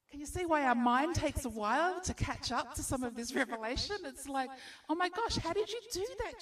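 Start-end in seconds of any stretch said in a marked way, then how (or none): 4.9-6.4 high, quick burst of astonished disbelief; acted awestruck exclamation; mock amazement. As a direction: marvel at it in a fast, raised voice. Imagined amazed reaction to Jesus.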